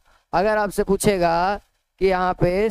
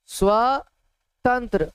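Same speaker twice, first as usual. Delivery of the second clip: drawn out; 2 semitones higher, much slower than usual. Shown as a demonstration. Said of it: "teacher dictating definition".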